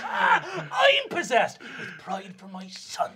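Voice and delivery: slow laughing proud shout; booming laugh. Acted. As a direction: roar it out laughing.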